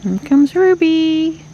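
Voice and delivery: high, slow cooing at a pet; pet talk. Real, not acted. Greeting the cats.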